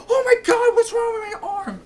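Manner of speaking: imitating a zombie's hysterical shriek; freaking out. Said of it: "he freaks out when he sees his arm".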